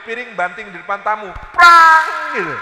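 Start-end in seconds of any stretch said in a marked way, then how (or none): 1.5-2.3 bursts out a loud crash of breaking china; a sudden, loud, mimicked smash; smashing-plate sound effect. Plate smashed before the guest.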